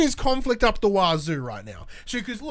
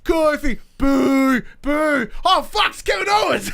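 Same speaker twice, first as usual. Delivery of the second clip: high, loud crowd roar ending in a shout; crowd cheer turning to shout; 7 semitones higher, 6 dB louder, slower than usual. Retold or imitated. Host acting out the arena crowd reacting: high loud shouting. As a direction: play the whole crowd, cheering then stunned. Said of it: "They were like".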